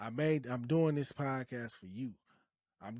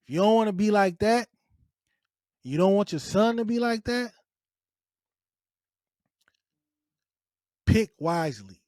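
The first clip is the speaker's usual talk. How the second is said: raised voice, much higher and louder; loud warning; 6 semitones higher, 11 dB louder than usual. Real, not acted. Podcast host warning male listeners, loud and urgent. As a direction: drive the warning home, hard.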